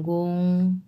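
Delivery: গং of গংসি with rounded lips; rounded gong. Shown as a demonstration. Teacher shows the lip rounding of গং. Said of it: "মুখটা round shape হবে".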